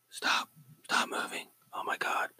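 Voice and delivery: hushed, spooked whisper. Pretend. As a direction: whisper it as if a ghost is near. Spooked by a mouse moving itself.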